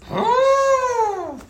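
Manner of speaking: very high, loud mock-astonished squeal; incredulous elephant voice. Elephant mocking the trainer, high incredulous squeal. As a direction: squeal it in disbelief. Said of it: "the elephant could be like".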